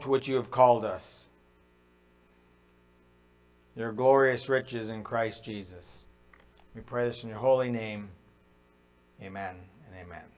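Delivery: low, slowing into a hushed amen; prayer. Real, not acted.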